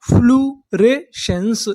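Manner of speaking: फ्लूरेसेंस drawn out slowly; slow sounding out. Shown as a demonstration. Teacher to students, slow syllable demo. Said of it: "तो हमारी पूरी meaning क्या बनी".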